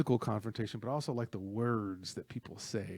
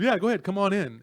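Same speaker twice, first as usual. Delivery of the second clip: raised, higher voice; loud, acted-out invitation to attack; retold challenge; 8 semitones higher, 9 dB louder, faster than usual. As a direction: call them in to fight, loudly. Acting out hero inviting attackers.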